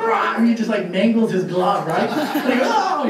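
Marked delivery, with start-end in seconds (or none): 0.0-0.3 sudden high-pitched imitated snarl; dog snarl. Little dog mangles the inspector's glove, mock snarl. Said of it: "and the dog goes". 2.7-3.1 high-pitched comic yelp; mock pained cry. Inspector pulls his bitten hand out, mock yelp. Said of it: "He pulled his hand out".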